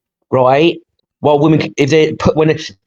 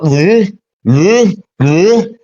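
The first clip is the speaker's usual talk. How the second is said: mock clueless grunts, pitched up; dopey baffled noise; 4 semitones higher, much slower than usual. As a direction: blurt out dumb noises, mimicking a helpless idiot. Mocking men baffled by laundry.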